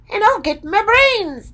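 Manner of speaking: high excited Scarecrow voice; eager Scarecrow. A narrator's reading. Scarecrow boasting of his brains, high and excited. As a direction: squeal about the brains. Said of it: "said the Scarecrow".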